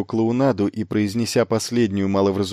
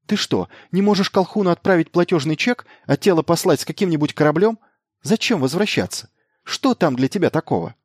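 high, insistent string of questions; protesting; 8 semitones higher than usual. A narrator's reading. Elena arguing against Miles going back, high-pitched protest. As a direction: protest urgently in a raised pitch. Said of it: "запротестовала Елена".

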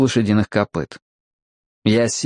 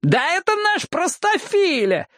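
very high-pitched hollering, no real volume; character's yell. A narrator's reading. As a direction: cry out high in a put-on voice. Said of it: "завопил Джексон". (вопль высоким голосом, без настоящего крика)

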